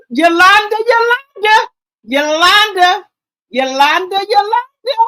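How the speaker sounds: high, loud sing-song call of her name; calling Yolanda. Real, not acted. Vlogger calling out to friend Yolanda, high teasing call.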